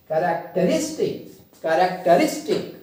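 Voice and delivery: Characteristic with RIS punched; characteristic said ka-rak-te-RIS-tik; stress on RIS. Shown as a demonstration. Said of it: "ये जो रिस portion है".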